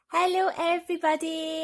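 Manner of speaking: put-on excited hello to the children; bright big hello. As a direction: welcome the whole class with energy.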